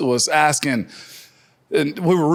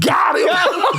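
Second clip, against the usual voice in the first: high gleeful shout; 13 semitones higher than usual. Retold or imitated. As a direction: crow it like you just scored. Team cheering the joke.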